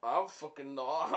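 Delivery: ordinary talk, nothing marked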